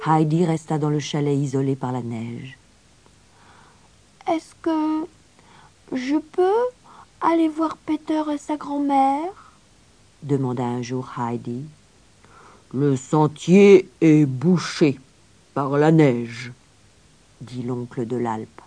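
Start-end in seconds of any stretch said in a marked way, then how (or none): 4.3-9.5 put-on high, halting child's voice; little girl asking. Heidi timidly asks grandfather permission. 12.7-16.5 put-on very slow, firm old man; gruff grandfather. Grandfather refuses the child's request.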